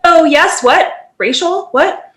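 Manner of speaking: high, puzzled what, racial, what; a child hearing her own name, baffled; startled confusion. Retold or imitated. Remembering school, she mimics her confused reaction. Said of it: "and I was like".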